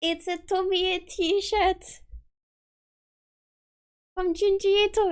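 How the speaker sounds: high, slow and thrilled; squealing fan unveiling merch; excited. Real, not acted. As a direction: show off the shirt with real delight. Unveiling a Junji Ito t-shirt.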